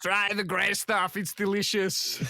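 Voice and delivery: put-on slow, heavy Schwarzenegger-style accent; slow, lightly voiced Arnold impression; mock Arnold accent. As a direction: drift into a bad Arnold. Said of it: "It does go Arnold".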